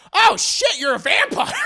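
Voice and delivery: high, loud panicked yelp, breaking into laughter; startled shout. Retold or imitated. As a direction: shriek it out, caught off guard. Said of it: "and Dio's like".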